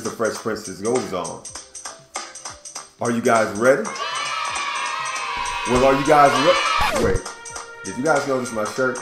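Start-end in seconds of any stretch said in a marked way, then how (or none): none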